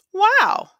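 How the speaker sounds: high, delighted wow as the shoes fit; surprised wow. Acted. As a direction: let the surprise leap up into a bright wow. Girl tries on comfy shoes.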